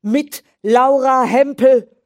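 high, loud defiant shout. Acted. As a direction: fling the name at her. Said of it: "schrie Graf Egon weiter". (trotziger Schrei)

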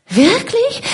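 put-on high, breathless society girl; gushing Isabella. Isabella to Catherine, high gushing. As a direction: swoop up, all fake delight.

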